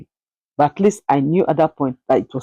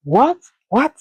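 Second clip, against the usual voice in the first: shocked what; 7 semitones higher, slower than usual. Retold or imitated. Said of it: "they were like".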